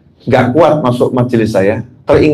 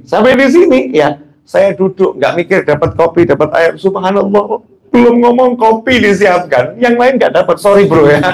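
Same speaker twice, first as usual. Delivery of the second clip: teasing excitement; 7 semitones higher than usual. Real, not acted. Teasing the others about coffee.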